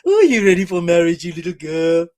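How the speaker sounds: hammed-up creepy baby-talk voice; creepy cooing man. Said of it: "And he thought".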